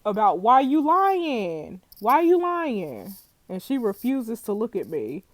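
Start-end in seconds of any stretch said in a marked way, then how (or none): 0.1-3.2 slow, loud stretched question; drawn-out plea. Acted. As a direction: drag out the question. Pleading with lying suspect.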